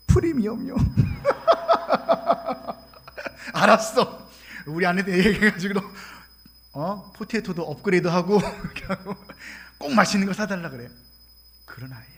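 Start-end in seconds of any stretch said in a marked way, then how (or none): 0.1-0.8 put-on high, loud bashful kid asking for the premium meal; a hint of a bashful girl, pitched high; shy child. Shy girl telling the pastor her McDonald's choice, high and bashful. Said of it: "수줍은 마음으로". 0.8-3.5 loud breathy burst of laughter; big laugh. Real, not acted. Preacher laughing at the child's choice, loud and breathy. 3.5-10.9 cheerful promise. Retold or imitated. Pastor's happy reply to child.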